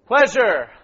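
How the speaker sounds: loud single-word punches; emphatic call-out. Real, not acted. Leader prompting recall of the verses, emphatic call-out. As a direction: toss each word out forcefully to the room. Calls out key words from verses.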